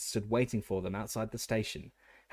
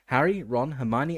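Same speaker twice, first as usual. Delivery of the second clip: plain reading voice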